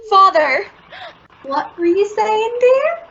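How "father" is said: loud sudden cry of alarm; startled call. Acted. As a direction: call out in fright. Mother startled, calls husband.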